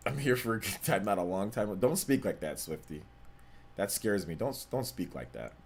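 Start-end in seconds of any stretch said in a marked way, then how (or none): none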